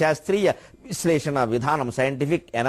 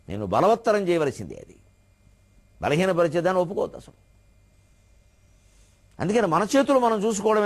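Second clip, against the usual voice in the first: ordinary talk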